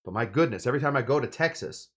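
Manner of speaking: ordinary talk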